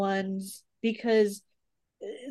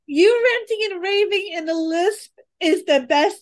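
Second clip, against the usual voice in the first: laughing; 6 semitones higher, 4 dB louder than usual. Real, not acted. Laughing at friend's rant.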